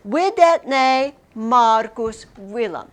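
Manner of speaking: Videtne Marcus Willam spoken slowly and deliberately; slow model sentence. Shown as a demonstration. Teacher repeating the Latin sentence for the class, slow. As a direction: lay out each word slowly.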